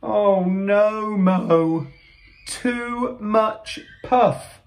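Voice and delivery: high, long-drawn wail of dismay; storybook alarm, slowing at the end; dismayed cry. Acted. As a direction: stretch it out high in mock horror. Friends' dismay at second mishap.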